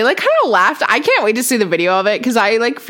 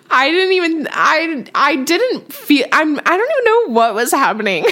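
flustered; 7 semitones higher than usual. Real, not acted. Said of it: "I don't know why I was so nervous".

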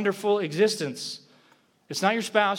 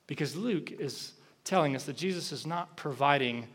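ordinary talk

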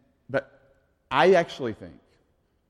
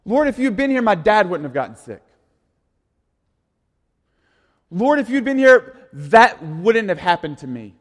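anguished plea to God; 5 semitones higher, 8 dB louder than usual. Retold or imitated. Voicing people's anguished complaint.

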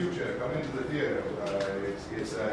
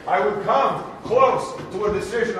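ordinary talk

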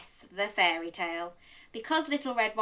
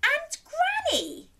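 put-on slow, very high little girl; said carefully; slower than usual. Little Red questioning odd Granny, careful and high. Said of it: "Little Red said carefully".

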